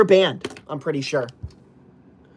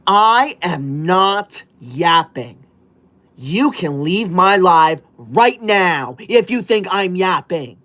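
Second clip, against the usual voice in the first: slow indignant denial; slower than usual. Acted. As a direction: insist word by word. Offended by yapping comment.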